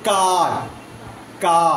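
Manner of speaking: কার with a long, wide-open আ; open, elongated vowel. Shown as a demonstration. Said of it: "এটা more open, more elongated".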